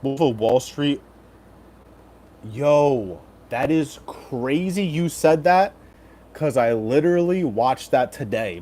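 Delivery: ordinary delivery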